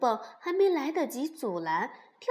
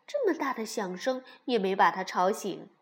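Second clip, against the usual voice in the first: usual speaking voice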